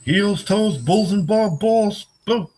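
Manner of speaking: high, slow cartoon voice; sing-song it way up high; high goofy character voice; high silly voice. High put-on voice.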